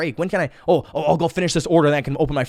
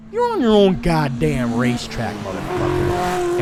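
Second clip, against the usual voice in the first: friend's blunt advice; 2 semitones higher, 3 dB louder, slower than usual. Retold or imitated. Friend telling him to run his own race, slow and drawn out. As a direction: stretch it out like a wise buddy. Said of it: "have my friend say that".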